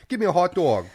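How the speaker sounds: loud, high New York street order; New York accent. Acted. Comic as a New Yorker wanting food, loud New York accent. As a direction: call it out in mock New York. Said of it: "whenever I hear New York".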